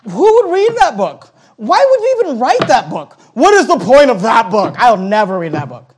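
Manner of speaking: put-on high, scornful teenager voice; mock teenage scorn. Pastor imitating his teenage self dismissing a book, high. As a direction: sneer like a know-it-all sixteen-year-old.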